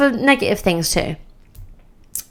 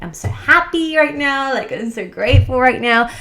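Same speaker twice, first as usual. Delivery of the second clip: bright, higher, bubbly lilt; giddy happy; 5 semitones higher than usual. Shown as a demonstration. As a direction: sound over the moon. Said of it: "see how that affects your brain".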